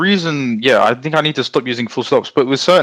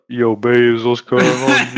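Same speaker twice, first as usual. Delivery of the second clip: lightly put-on chat-up voice; voice-memo guy; 3 semitones lower, much slower than usual.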